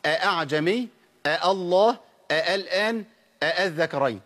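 each word begun a-'a with two distinct catches; original two hamzas. Shown as a demonstration. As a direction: separate both hamzas at the start of أعجمي, الله, الآن, الذكرين. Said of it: "أصل الكلمات دول إيه؟".

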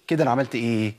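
normal talking voice

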